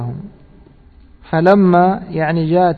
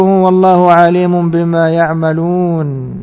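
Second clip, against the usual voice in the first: Quran recitation; 4 dB louder, slower than usual. Acted. Reciting Quran verse in lesson.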